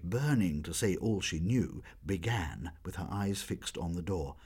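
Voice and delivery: plain reading voice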